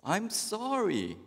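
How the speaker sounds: high, slow, sheepish sorry; meek husband apology. Acted. Husband apologizes to wife, high and slow. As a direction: stretch the apology out helplessly. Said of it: "The husband says".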